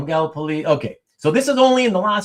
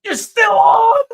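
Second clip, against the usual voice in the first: excited exclamation; slower than usual. Retold or imitated. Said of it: "the first thing he says is".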